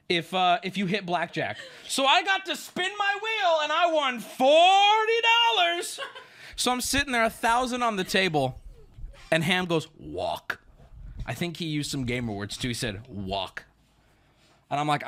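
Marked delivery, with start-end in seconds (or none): 1.9-6.0 high wailing hype over a tiny prize; hammed-up squealing celebration; mock triumph. 10.0-10.6 very deep, soft friend impression; imitated low, quiet command; grave advice. 13.1-13.6 very deep, soft friend impression; imitated low, quiet command; grave advice.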